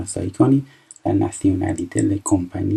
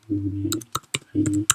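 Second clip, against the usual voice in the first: امریکن drawn out slowly and softer; slow soft repeat; 5 dB quieter, much slower than usual. Shown as a demonstration. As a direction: slowly spell out امریکن for the class.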